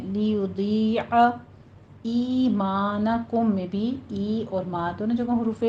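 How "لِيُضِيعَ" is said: لِيُضِيعَ slow, light lam, long ya; drawn-out ya. Shown as a demonstration. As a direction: recite لِيُضِيعَ with the ya stretched. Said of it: "کھینچ کے پڑھنا ہے".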